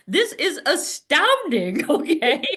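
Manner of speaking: high, slow exclamation of amazement; amazed. Real, not acted. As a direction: marvel out loud, drawn out and high. Teacher marvels at prompt arrivals.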